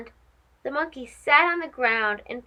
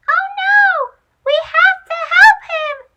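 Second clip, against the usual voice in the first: very high, loud mouse crying out; urgent squeal to spring into action; alarmed cry; 8 dB louder than usual. Acted. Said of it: "cried Chico".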